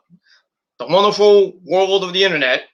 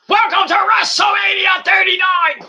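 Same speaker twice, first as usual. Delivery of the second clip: much higher, loud arena announcer bellow; hammed-up ring announcer calling to the crowd; announcer shout; 4 dB louder than usual. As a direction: boom it out like a wrestling ring announcer. Sudden mock ring announcer.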